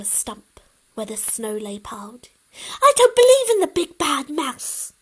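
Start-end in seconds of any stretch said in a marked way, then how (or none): 2.8-4.9 higher and louder, bold little monster; a child proving she's not scared; defiant high child voice. Acted.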